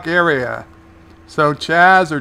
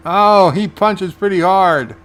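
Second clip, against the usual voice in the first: wincing reaction; 5 semitones higher, 5 dB louder than usual. Real, not acted. Reacting to the fight.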